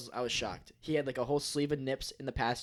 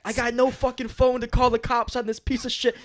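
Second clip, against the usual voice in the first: loud, high retold angry rant; pissed-off rant; 10 semitones higher, 7 dB louder than usual. Furious passer-by ranting about calling the cops, loud rant. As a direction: rage about having no phone. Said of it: "He's fucking pissed".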